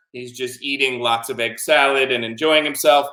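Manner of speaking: plain reading voice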